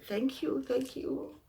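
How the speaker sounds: high, pleading voice of a poor traveller; pleading poor man. Acted. Poor man thanks king.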